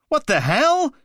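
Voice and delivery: very high, loud shocked shout; outraged yell. Acted. The Mantis yelling after tea soaks his shirt, outraged.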